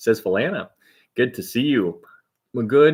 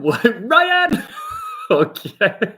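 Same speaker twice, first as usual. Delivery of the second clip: laughing; 11 semitones higher, 3 dB louder, much slower than usual. Real, not acted. Chat typing Ryan in capitals again.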